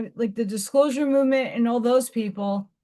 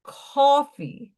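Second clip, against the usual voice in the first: New York accent; 4 semitones higher than usual. Shown as a demonstration. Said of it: "I'm losing my New York accent".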